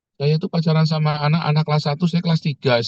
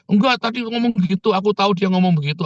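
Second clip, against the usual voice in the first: high-pitched imitated arguing; acted-out know-it-all protest; mock insistence; 7 semitones higher than usual. Imitated member insisting in dispute.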